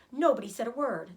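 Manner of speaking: plain reading voice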